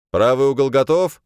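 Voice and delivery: loud referee call across the arena. Acted. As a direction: announce it to the hall.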